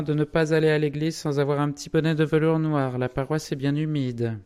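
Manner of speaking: high, loud put-on woman's voice; high-pitched, insistent advising; officious niece. A narrator's reading. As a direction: press advice on an uncle loudly. Madame Massin officiously advising her uncle.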